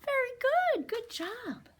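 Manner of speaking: delighted librarian praise; excited praise. Real, not acted. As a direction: cheer them on way up high. Praising children's chirping.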